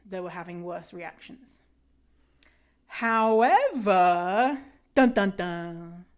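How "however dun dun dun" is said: dramatic suspense. Acted. Dramatic twist in the abstract.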